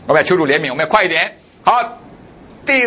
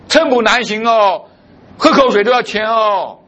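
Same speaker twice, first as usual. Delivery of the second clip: complaining believer; 8 semitones higher than usual. Retold or imitated. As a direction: sigh it out like someone longing for the village. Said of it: "有的弟兄姐妹讲".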